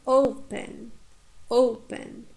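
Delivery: "Open" with a strong O and short second syllable; "Open" demonstrated as O-pen, falling at the end; stress on first syllable. Shown as a demonstration. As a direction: hit the start of "Open". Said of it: "we stress the first syllable".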